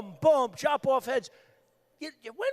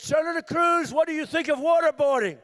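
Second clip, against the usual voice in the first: loud moderator; 5 semitones higher, 6 dB louder than usual. Retold or imitated. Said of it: "They said".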